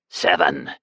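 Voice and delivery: put-on low, croaking old beggar; croaked. A narrator's reading. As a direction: croak the bid out. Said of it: "croaked Baslam".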